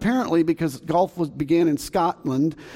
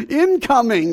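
mock warning call; 7 semitones higher, 5 dB louder, slower than usual. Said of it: "why golfers just don't say".

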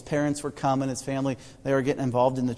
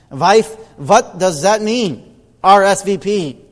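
put-on Central European immigrant accent, loud and slow; a loud, slow husband with a thick Eastern European accent; thick foreign accent; 5 semitones higher, 11 dB louder, slower than usual. Immigrant husband puzzling to his wife, thick Eastern European accent. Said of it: "the husband said in his thick accent".

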